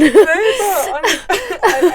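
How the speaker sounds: crying with laughter, high and loud; helpless laughter. Real, not acted. Friends cracking up over the bar story, crying with laughter. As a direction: laugh until you can barely speak.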